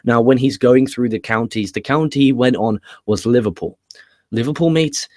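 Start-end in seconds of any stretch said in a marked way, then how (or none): 4.3-5.1 higher-pitched Scouse impression; playful mock Scouse; Scouse accent. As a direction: put on a Liverpool accent, pitched up. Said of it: "I can do a Scouse accent pretty well".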